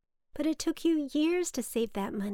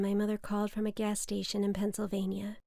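plain reading voice

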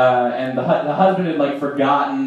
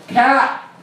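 a tourist mom's hammed-up Boston accent, higher, saying "Cat"; mock Boston accent; 10 semitones higher than usual. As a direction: teach kids "cat" for car, in a put-on Boston accent. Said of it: "trying to teach them the Boston accent".

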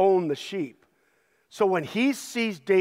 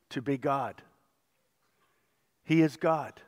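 ordinary delivery

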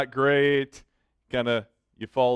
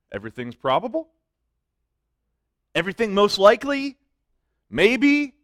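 mock doubt; 6 semitones higher, 4 dB louder than usual. Mocking weaker wording.

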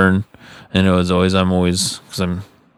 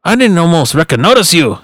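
imitated uncle; 10 semitones higher, 5 dB louder than usual. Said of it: "He was like".

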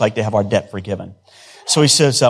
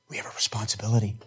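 much softer and quicker, almost under the breath; soft first take; 11 dB quieter, faster than usual. Shown as a demonstration. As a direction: drop the voice and toss it off quickly.

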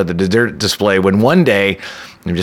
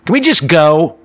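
impatient demand; 6 semitones higher than usual. Retold or imitated. Date demanding to leave.

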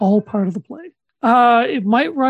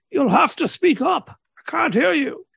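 put-on deaf old man voice; 6 semitones higher than usual. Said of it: "I have to play a, a jolly old deaf man".

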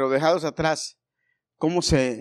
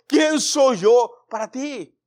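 slow, solemn question as Jesus; voicing Jesus; 7 semitones higher, 6 dB louder, much slower than usual. Jesus asking each listener who he is, solemn. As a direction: ask it slowly as the Lord would.

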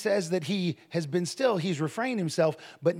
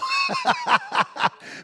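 high burst of laughter; amused high laughing; 4 dB louder than usual. Real, not acted. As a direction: laugh loudly at your own joke. Laughs at his own joke.

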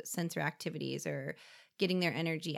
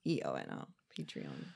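quiet realization; 8 dB quieter than usual. Real, not acted. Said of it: "P A T R E O N".

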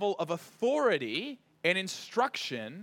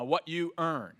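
usual speaking voice

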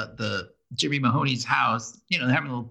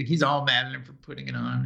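normal talking voice

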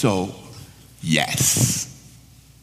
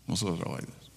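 hushed echo; 11 semitones lower, 14 dB quieter, faster than usual. Shown as a demonstration. Preacher repeats line, dropping down.

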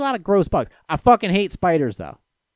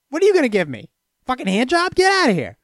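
mock celebrity brush-off; 6 semitones higher than usual. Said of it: "It's like".

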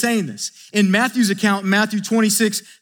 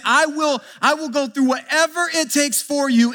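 high-pitched, insistent vow; [name]'s emphatic promise, voice raised in pitch; 7 semitones higher than usual. Acted. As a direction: pledge it emphatically, pitched well up. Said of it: "[name] is emphatically saying".

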